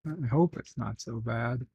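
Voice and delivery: soft, doubtful aside; hushed second thought; doubtful afterthought. Real, not acted. Backs off the reassurance.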